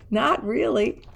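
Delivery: ordinary delivery, nothing marked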